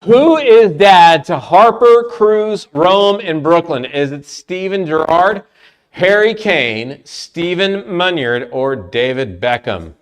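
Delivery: game show host reading choices; quiz host announcement. Pretend. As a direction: announce each name slowly for suspense. Host reads question and choices.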